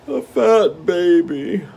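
slow, high, longing tiger; a drawn-out craving from the hungry tiger. Acted. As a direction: savour the words with greed.

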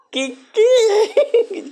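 grito muy agudo y más fuerte, entre risa y llanto; grito de asombro. Real, not acted. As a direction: explota de incredulidad. Descubre que tiene una hermana. ("¿qué, qué?" gritado muy agudo entre risas)

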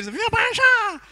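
very high nasal cheer; hammed-up honking slogan; nasal speech impediment. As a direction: chant it through the nose, high. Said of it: "um gajo que é fanhoso".

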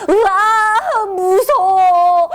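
high, drawn-out scared cry; boy waking from a dinosaur nightmare, scared; frightened child whimper. Acted.

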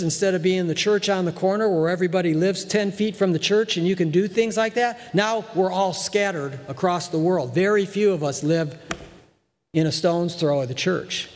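ordinary talk

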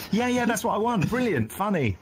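high, gushing mock praise; delighted approval. Director approving the actor's monster voice, imitated delight.

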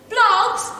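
high, loud call; teacher calling her caretaker. A narrator's reading. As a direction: summon the caretaker loudly. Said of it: "she called".

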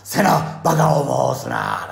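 loud, slow stern rebuke; stern scolding. Retold or imitated. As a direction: scold her firmly. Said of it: "なんて渡部篤郎さんね".

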